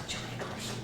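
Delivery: breathless starstruck whisper; awestruck whisper. Retold or imitated. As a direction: hush it like seeing your idol.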